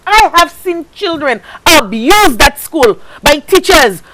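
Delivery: usual speaking voice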